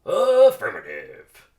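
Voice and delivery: loud, slow drawn-out affirmative; loud slow radio reply; pilot affirmative. Acted. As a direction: confirm like a pilot. Radio confirmation.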